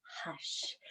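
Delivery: soft unvoiced whisper; the old lady's whispered hush. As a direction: breathe the word out in a hush. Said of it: "the little old lady whispering".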